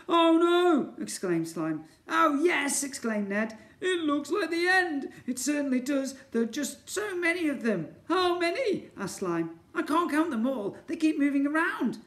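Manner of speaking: high, loud panicky cries; panicked characters. Acted. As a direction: voice the cornered pair in panic. Panicked Ned and Slime.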